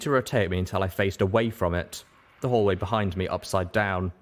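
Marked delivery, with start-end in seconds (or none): none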